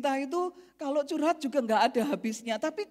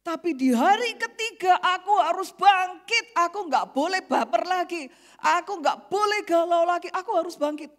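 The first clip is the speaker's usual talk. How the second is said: resolute; 4 semitones higher, 7 dB louder than usual. Retold or imitated. Quoting a woman's resolve.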